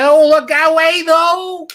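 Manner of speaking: very high, loud comic wail; hammed-up outburst, held at full pitch; Jerry Lewis. Comedian pretending to staple himself, hammed-up wail. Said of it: "he went like this".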